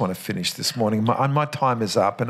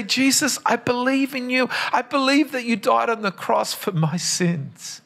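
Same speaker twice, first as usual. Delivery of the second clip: raised, earnest prayer spoken higher; modelled prayer; 9 semitones higher than usual. Acted. Pastor showing the congregation how to pray, raised and earnest. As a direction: lift the simple prayer up for them to follow.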